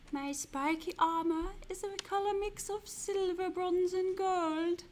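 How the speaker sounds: hammy portentous wizard. Pretend. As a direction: declaim the clue as a grand wizard. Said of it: "Shall I drop the wizard's voice".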